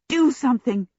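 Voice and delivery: an anxious aside to a companion; urgent appeal. A narrator's reading. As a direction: press them to act, without shouting. Sophie urges Abdullah amid the screaming.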